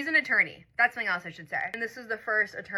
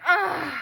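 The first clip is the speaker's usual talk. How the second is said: disappointed groan; 8 semitones higher, 5 dB louder than usual. Retold or imitated. Groaning at his leaving date.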